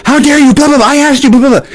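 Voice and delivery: very high-pitched nagging imitation; high, gibberish-filled mock scolding; mock hollering. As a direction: mimic her tirade in a high, babbling squawk. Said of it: "she's hollering at me".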